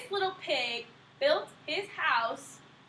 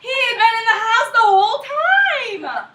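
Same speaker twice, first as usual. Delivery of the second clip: excited; 7 semitones higher, 15 dB louder than usual. Acted. Excited storyteller exclaims.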